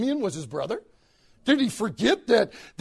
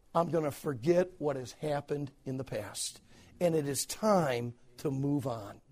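gentle vow; 8 semitones lower, 8 dB quieter than usual. Retold or imitated. Joseph to himself about his past, softly resolved. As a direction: speak it gently like letting go.

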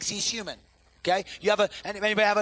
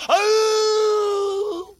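long high loud husky howl; 13 semitones higher, 7 dB louder than usual. Retold or imitated. Son howling at the dog, long high loud howl. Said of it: "He'll do the exact same tone".

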